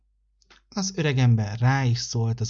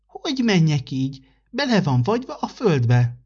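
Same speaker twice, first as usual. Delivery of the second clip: put-on higher childlike voice, a little whiny; little fir tree; 6 semitones higher than usual. The fir tree complains to December, higher and whiny. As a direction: fret like a small tree stuck in the frozen ground.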